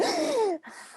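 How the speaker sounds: a high sudden gasp of cold shock; sharp gasp. Shown as a demonstration. Teacher demonstrating the cold-shower shock with a gasp. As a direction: gasp as if icy water just hit you.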